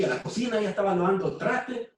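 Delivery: ordinary delivery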